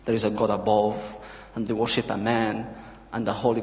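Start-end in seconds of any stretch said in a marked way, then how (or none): none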